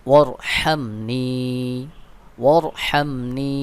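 ওয়ারহামনি with the হা pressed from mid-throat, slow. Shown as a demonstration. Teacher showing the throat হা in ওয়ারহামনি. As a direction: push the হা in ওয়ারহামনি from the middle of the throat. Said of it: "গলার মধ্যখান থেকে চাপ দিয়ে উচ্চারণ করবেন".

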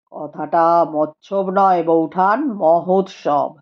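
a calm scholar speaking gravely; Rupendra's voice. A narrator's reading. Rupendra corrects her. (নিচু গম্ভীর গলায় পণ্ডিত রূপেন্দ্র)

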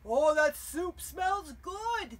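loud, drawn-out goblin voice. Acted. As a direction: savour it like a hungry goblin. Goblins praising the soup.

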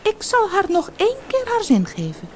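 high, grudging fish voice; a weary magic fish granting one last wish. Acted. Golden fish grants the fisherman's wish, high and grudging.

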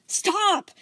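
high, loud shout of stop. Acted. Steven stepping between two arguing gems, shouting. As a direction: cut the fight off hard.

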